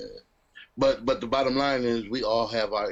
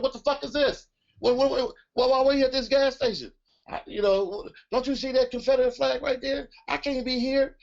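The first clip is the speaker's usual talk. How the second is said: high, frantic panic over banjos; panicked; 12 semitones higher than usual. Retold or imitated. To girlfriend at a Confederate-flag gas station, high panic. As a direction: voice shoots way up in alarm. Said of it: "I was like".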